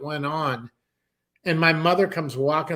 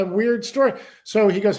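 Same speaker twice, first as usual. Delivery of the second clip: normal talking voice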